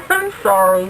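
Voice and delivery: sorry mid-laugh; laughing apology. Real, not acted. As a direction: apologise while still giggling. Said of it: "I'm very distracted now".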